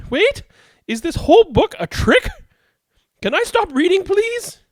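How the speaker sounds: high comic pleading. Acted. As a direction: beg to stop, voice climbing. Begging to stop reading.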